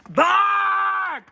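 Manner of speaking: high, very loud bark; booming bark. Acted. Watchdog with a big bark, shouted.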